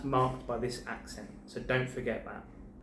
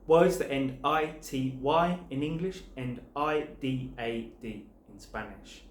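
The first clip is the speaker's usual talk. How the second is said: ordinary talk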